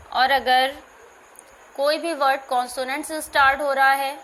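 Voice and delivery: usual speaking voice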